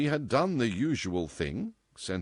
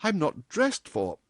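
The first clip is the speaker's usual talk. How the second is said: higher, slow and unfinished; breaking off lamely; 8 semitones higher than usual. A narrator's reading. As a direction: let the excuse tail away. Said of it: "she broke off lamely".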